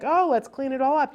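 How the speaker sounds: put-on high, loud cheer to a child; exaggerated upbeat parent voice; playful exclaiming. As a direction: make tidying sound like fun. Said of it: "And be like".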